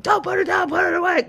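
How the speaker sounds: high-pitched yelled house rule; mock parental yell. Mom telling her kids the rule, high mock yell. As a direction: holler it through the house, playfully acted. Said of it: "I'll yell it. I'm like".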